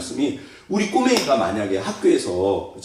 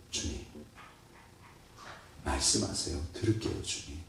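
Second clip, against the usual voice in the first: hushed prayer; 9 semitones lower, 16 dB quieter than usual. Acted. Quiet acted prayer of listening.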